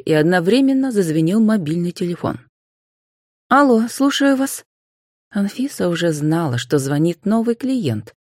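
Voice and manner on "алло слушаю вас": high-pitched businesslike hello; answering the phone. A narrator's reading. Anfisa answering a new client's call, politely.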